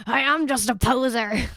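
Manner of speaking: high, loud theatrical wail; exaggerated self-mocking outcry; mock dismay. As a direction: declare it in hammed-up despair. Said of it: "I was like".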